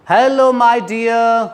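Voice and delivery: slow, stretched-out "Hello my dear"; slow demo. Shown as a demonstration. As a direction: say it slowly like a sweet greeting. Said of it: "इस तरीके से बोलता है". (धीरे से "Hello my dear")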